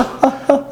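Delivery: high burst of laughter; amused laugh. Real, not acted. Amused at prison equalling marriage score. (어이없어 터진 높은 웃음)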